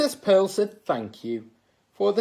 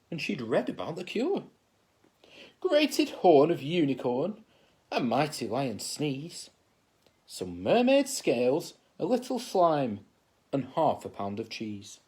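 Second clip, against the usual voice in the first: ordinary delivery, nothing marked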